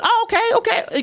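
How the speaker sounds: high, sing-song oh okay; polite falsetto. Retold or imitated. Answering the driver, high placating oh okay. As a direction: placate her with a high chirp.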